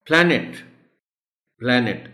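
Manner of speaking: "پلانٹ" as PLA-net, vowel as in cat; model pronunciation. Shown as a demonstration. Teacher drilling the first vowel, "پلانٹ" as PLA-net. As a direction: say "پلانٹ" twice, high, open A first. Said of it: "اے sound ہے شروع میں".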